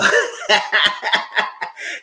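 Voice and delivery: loud burst of laughter; hearty high cackle; laughing. Real, not acted. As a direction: break into high, breathy laughter. Laughs while wrapping up review.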